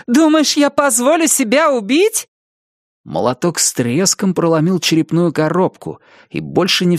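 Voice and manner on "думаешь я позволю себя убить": high, fierce fighting taunt; defiant taunt. A narrator's reading. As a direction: taunt while swinging. Aza fights the ghost.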